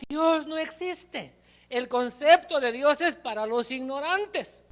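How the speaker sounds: high, slow, scornful declaration; a stern father dismissing God; atheist father. Acted. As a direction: sneer at belief like a stern unbeliever. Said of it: "Decía".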